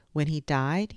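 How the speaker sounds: ordinary delivery, nothing marked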